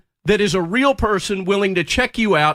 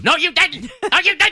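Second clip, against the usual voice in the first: computerized voice; 10 semitones higher than usual. Retold or imitated. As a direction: bark it back like a machine. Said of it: "this computerized voice yells back at you".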